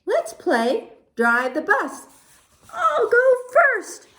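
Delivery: high, eager pigeon voice; scheming pigeon. Acted. Pigeon suggests playing Drive the Bus, high eager voice. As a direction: pitch it high and drawn out, proposing a game. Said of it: "He says".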